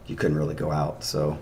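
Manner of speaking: ordinary talk, nothing marked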